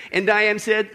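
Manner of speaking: ordinary delivery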